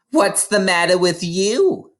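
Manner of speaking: put-on a stern mother waking her child; mother's voice.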